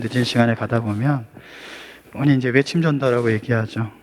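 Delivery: ordinary talk, nothing marked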